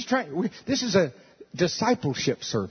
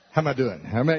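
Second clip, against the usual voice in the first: usual speaking voice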